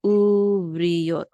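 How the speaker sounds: Uvriot loud and drawn out syllable by syllable, uv-ri-yot; with the yud. Shown as a demonstration. Teacher modelling the corrected word for learners, slow loud Uvriot with a y. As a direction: stretch Uvriot out, y gliding into the final ot. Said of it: "This syllable is with the yud".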